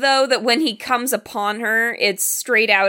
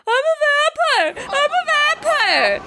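high shouted vampire cry; hammed-up wailing shout; mock shout. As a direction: shout it like a madman in the street. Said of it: "running down the street shouting".

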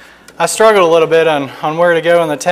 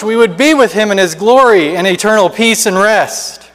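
higher, anguished lament of the lost; hammed-up cry of despair; crying out in agony; 7 semitones higher, 5 dB louder than usual. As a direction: wail the regret of the damned. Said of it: "crying out in agony".

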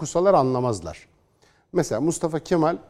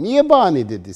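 mocking critic's question; 4 semitones higher, 7 dB louder than usual.

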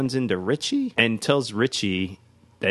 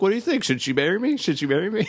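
high-pitched imitation of eagerly asking strangers; mock-excited, high questioning; excited retold pleading; 9 semitones higher, much faster than usual. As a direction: play an eager groom polling passers-by, pitched up. Acting out excited man asking strangers.

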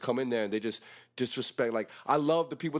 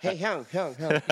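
laughing imitation of a high-voiced guy; 3 semitones higher, 3 dB louder, slower than usual. Mocking the acquaintance's greeting, high laughing voice. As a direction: mimic his high greeting through laughter. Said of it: "he has a high voice".

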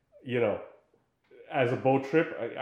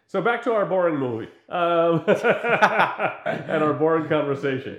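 mock boredom, laughing; 4 semitones higher, 5 dB louder, slower than usual. Real, not acted. Mocking their own movie talk.

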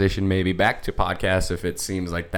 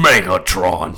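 Optimus Prime voice; 3 semitones lower, 7 dB louder, slower than usual. Pretend. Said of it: "Say Megatron".

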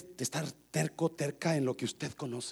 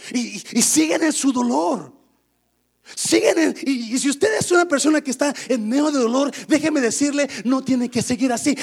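high, very loud exhortation; full-force preaching; 10 semitones higher, 17 dB louder than usual. Real, not acted. Preacher urging those in pain toward Jesus, full-force preaching. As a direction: preach it at the top of your voice.

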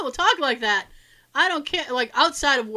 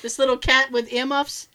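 ordinary talk, nothing marked

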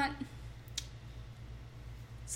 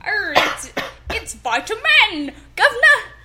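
higher, louder hammed-up pirate; pirate accent; 6 semitones higher, 5 dB louder than usual. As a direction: ham up the pirate, rising on Governor. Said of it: "I'm so good at accents".

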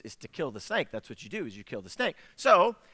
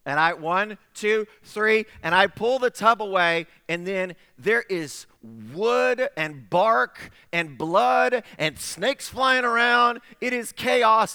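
louder, higher count into chaos; dramatic buildup; 5 semitones higher, 6 dB louder, slower than usual. Real, not acted. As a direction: build the tension, then burst. Narrating the climax, counting.

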